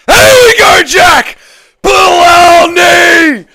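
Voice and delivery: very high, loud yelling; frantic cheering. Real, not acted. Streamer screaming at his fighter, frantic cheering. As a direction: scream him on with everything.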